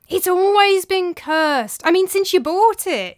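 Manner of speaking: high, loud, slow insistence; insisting. A narrator's reading. Tranio convincing his master, high loud insistence. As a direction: press the claim hard. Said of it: "insisted Tranio".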